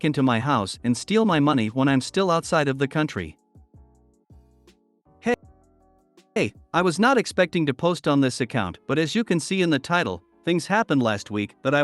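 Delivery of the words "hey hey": usual speaking voice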